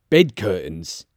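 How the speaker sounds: low, understated disbelief; name it low, taken aback; low-voiced old dealer; surprised old Joe. Joe puzzles over the cloth.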